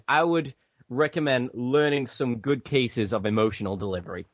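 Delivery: usual speaking voice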